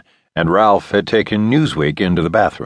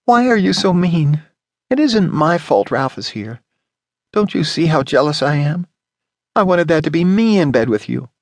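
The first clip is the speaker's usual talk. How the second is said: tearful; 9 semitones higher than usual. Acted. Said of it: "She suddenly dissolved into cunning little tears".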